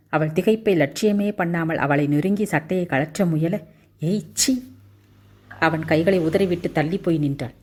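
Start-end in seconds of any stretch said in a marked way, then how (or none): 4.0-4.6 high, sharp "ஏய், சீ!" of disgust; disgusted cry. A narrator's reading. Heroine pushes away the pilot, disgusted. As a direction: recoil from him with a high shocked cry.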